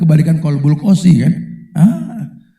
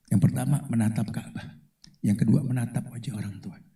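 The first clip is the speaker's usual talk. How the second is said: ordinary talk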